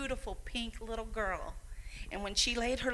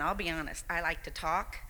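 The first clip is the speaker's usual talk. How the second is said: usual speaking voice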